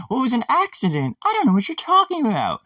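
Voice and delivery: very high mock-innocent voice; feigned innocence. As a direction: protest innocence in a squeaky put-on voice.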